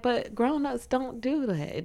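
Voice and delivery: put-on high, slow little girl; earnest child, drawn out and high; kid voice. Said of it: "She's like".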